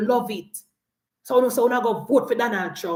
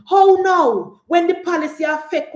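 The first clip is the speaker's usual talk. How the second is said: higher and louder, sharp jab; mocking outburst; 6 semitones higher, 4 dB louder than usual. Real, not acted. Jeering at voters now hurt by the policy, loud mocking jab. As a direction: fling the question at them.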